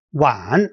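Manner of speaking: 晚 drawn out, going down then up; third tone. Shown as a demonstration. Teacher shows the third tone, 晚 falling then rising. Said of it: "the third tone".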